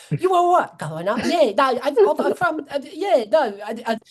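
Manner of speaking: high, loud mocking fake British accent; hammed-up bad London accent. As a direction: parody a terrible British accent. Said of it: "that British accent, man, is awful".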